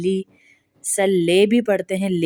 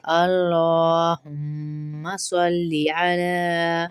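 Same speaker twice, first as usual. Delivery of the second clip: drawn-out recitation with heavy lam; slow recitation; much slower than usual. Acted. Teacher recites the correct durood for students. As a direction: chant it slowly and clearly.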